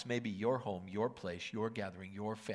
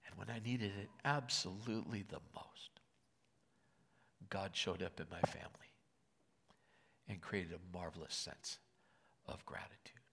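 emotional whisper; 3 semitones lower, 6 dB quieter than usual. Real, not acted. Emotional sermon close, whispered.

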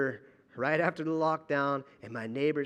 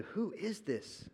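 awed hush; 3 semitones higher, 9 dB quieter, slower than usual. Retold or imitated.

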